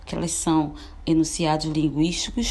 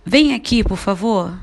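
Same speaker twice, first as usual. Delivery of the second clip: calling out; 2 semitones higher, 8 dB louder than usual. Shown as a demonstration. Teacher demonstrating a command, calling out loudly. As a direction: call someone over from afar. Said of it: "Um exemplo de uma frase imperativa".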